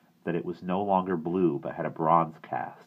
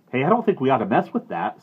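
higher, louder, quick warning; urgent warning; 8 semitones higher, 8 dB louder, faster than usual. A narrator's reading. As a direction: warn him off quickly. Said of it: "Sandy warned".

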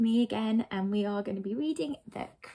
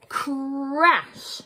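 high, loud sound-effect word; dramatic crash; 6 semitones higher, 5 dB louder than usual. A narrator's reading. Narrator announces a car hitting the hedge, dramatic crash. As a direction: burst out with a big crash.

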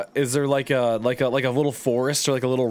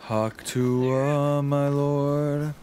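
meme quote; 2 semitones higher, much slower than usual. Retold or imitated. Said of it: "I don't remember if that's how it went".

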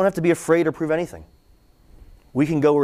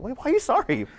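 lightly acted bewilderment; puzzled reply; 9 semitones higher than usual. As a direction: act out a baffled, disarming question. Acts out own puzzled reply.

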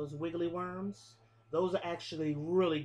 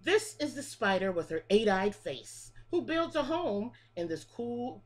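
lilting picture-book read-aloud, each line rising then falling; reading to children; 3 semitones higher, 3 dB louder than usual. Acted. Teacher reading a bug rhyme book to her class, sing-song. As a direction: read the rhyme like story time for little kids.

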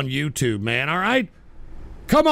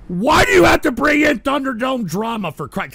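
louder, higher angry shout of the question; angry repeat; 8 semitones higher, 6 dB louder than usual. Real, not acted. Host yelling at prank caller, angry outburst. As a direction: blow up at the caller.

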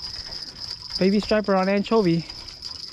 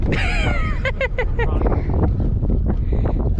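high laugh. Real, not acted. Laughing at hope.

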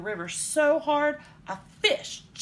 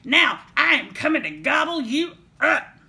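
loud, raised troll threat; 7 semitones higher, 12 dB louder than usual. A narrator's reading. Troll threatening to gobble the little goat, bellowing. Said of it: "said the troll".

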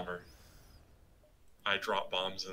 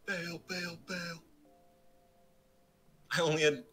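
urgent calling out; 9 semitones higher than usual. Real, not acted. Urging crew to abandon ship.

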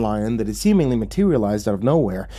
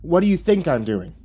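higher, irritated retort; shot back; 7 semitones higher than usual. Acted. Narrator digging, retorting testily to devil. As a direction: throw the question back testily. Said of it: "I shot back at him".

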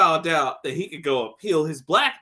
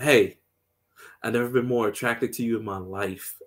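low smooth line; 6 semitones lower than usual. Retold or imitated. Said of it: "And he's like".

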